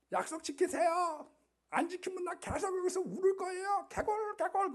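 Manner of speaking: 아주 높고 앵앵대는 개구리 목소리; 높은 목소리로 떼쓰듯 조르는 개구리. Acted. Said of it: "개구리가 거기서 막 웁니다". (약속을 지키라며 공주에게 떼쓰는 개구리, 아주 높은 목소리)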